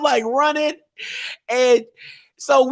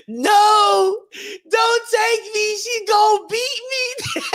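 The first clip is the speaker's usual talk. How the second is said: high-pitched drawn-out wail, acted; comic bawling, slow and high; mock crying; 10 semitones higher, slower than usual. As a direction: plead like a kid bawling, hammed-up. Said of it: "I just start busting out crying".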